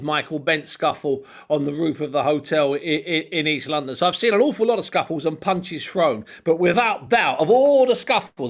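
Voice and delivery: ordinary talk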